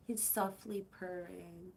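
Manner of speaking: normal talking voice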